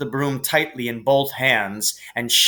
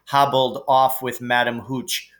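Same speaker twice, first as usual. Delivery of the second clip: ordinary delivery, nothing marked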